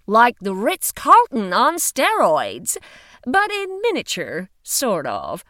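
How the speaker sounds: firm woman's voice. Acted. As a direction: speak up like a firm investigator. Female agent describing.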